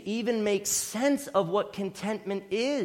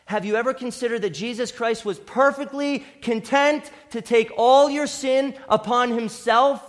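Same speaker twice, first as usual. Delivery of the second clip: louder, building sermon climax; preaching at full force; 3 semitones higher, 5 dB louder than usual. Acted. Preacher urging congregation toward Christ, rising passion. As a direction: press it home with mounting fervour.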